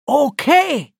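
high, loud, irritated reply; exasperated loud outburst. A narrator's reading. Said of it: "wiederholte sie lauter und mit einer gewissen Wut".

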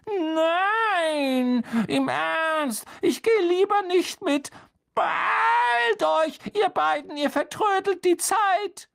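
very high, insistent squeal; shrill voice. A narrator's reading. Hermine sends the boys off through the door, shrill and urgent. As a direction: shrilly shoo them away. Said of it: "Eine schrille Stimme".

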